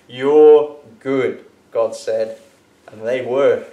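lively storybook reading, God's lines bold; animated children's story narration; reading to a child. A narrator's reading. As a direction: read it aloud like a bedtime Bible story. Reads a picture Bible to Daniel.

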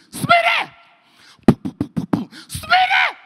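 very high, loud holler; calling out. Retold or imitated. Inmate banging the wall for his friend, loud high yell. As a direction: yell the name up to another cell. Inmate calls to another cell.

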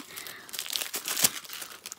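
long sucked-in breath of shock; astonished gasp. Real, not acted. Discovering what the friend sent, astonished gasp. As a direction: gasp at a wonderful surprise. Sees the gift inside.

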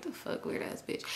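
very soft, whispered insult; hushed, voiceless grumble; muttered aside. Retold or imitated. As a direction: say it under your breath. Retold remark under her breath.

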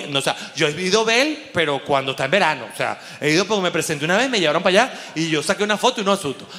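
ordinary talk, nothing marked

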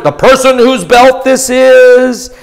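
slow, high, solemn proclamation; prophet's words. Acted. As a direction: intone it like a prophet.

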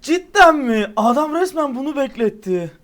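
high, loud exasperated yell at a rival; real disbelief, shouted at the screen; outraged shout. Real, not acted. Gamer yelling at the rival who held him back, outraged and loud.

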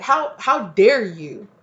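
loud, drawn-out mock indignation; mock affront. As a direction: draw it out as if deeply offended. Acts out the offended dog.

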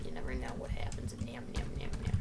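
unvoiced mock-ominous whisper. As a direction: hush it like a spooky secret. Spooky war joke whispered.

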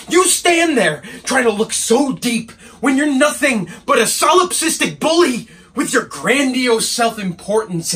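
angry. Acted. Assistant telling off his bullying painter boss, angry. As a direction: lay into him with contempt. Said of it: "he's quite angry".

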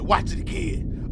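slow, unvoiced eerie whisper; spooky reading. Reading the ending screen in a slow eerie whisper. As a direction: whisper the message like a curse. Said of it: "it said".

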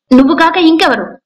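angry retort, pitched well up; provoked, high-pitched retort. A narrator's reading. Aunt rounding on her niece, provoked and high-pitched. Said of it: "రెచ్చిపోతూ తారాస్థాయిలో అంది పార్వతి".

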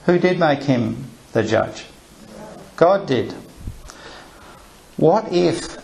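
normal talking voice

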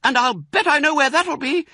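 put-on very high, loud child voice; smallest fox cub. A narrator's reading. Smallest fox guesses Dad's plan, eagerly. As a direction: pipe up, sure you know. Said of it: "said the only small fox now left".

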